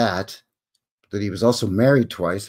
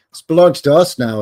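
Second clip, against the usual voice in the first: mock Canadian; 4 semitones higher, 9 dB louder than usual.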